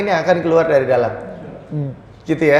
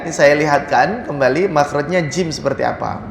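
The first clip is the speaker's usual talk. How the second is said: ordinary delivery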